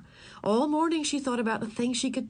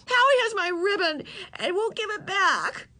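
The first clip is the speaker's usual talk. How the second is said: much higher, tearful complaint; upset girl telling her mother, much higher; near tears; 11 semitones higher than usual. Acted. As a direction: tell on him, nearly crying. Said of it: "so angry she was near tears".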